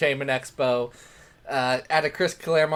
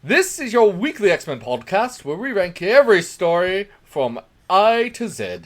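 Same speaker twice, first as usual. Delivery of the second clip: loud, hammed-up British podcast welcome; bad British accent; 4 semitones higher, 8 dB louder than usual. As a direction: announce it in a wandering mock-British accent. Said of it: "attempt a British accent 'cause God knows".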